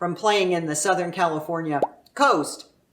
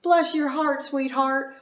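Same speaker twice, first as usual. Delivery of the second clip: sweet true blessing; 6 semitones higher than usual. Retold or imitated. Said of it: "can be used as a true blessing".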